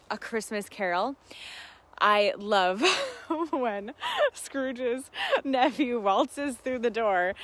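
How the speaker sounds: normal talking voice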